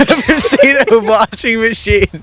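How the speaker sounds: cracking up mid-line; breaking into laughter while speaking; laughing. Real, not acted.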